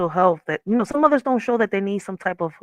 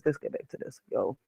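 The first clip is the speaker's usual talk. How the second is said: whispered to herself, almost silent; quiet whispered reminder to get back on track; 4 semitones lower, 14 dB quieter than usual. Shown as a demonstration. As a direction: breathe it under your breath. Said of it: "let's get back on this".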